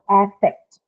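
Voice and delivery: "affect" as AF-fect, opening on the vowel of cat; noun pronunciation. Shown as a demonstration. Teacher modelling A F F E C T as a noun, AF-fect. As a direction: slowly sound out "affect" with a full cat vowel first. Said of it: "that takes up the first sound".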